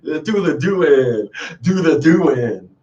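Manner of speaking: slow, playful drawl; drawn-out catchphrase. Pretend. Host showing off the catchphrase, slow and drawn out. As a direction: stretch each word out slowly. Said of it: "See what I'm doing?".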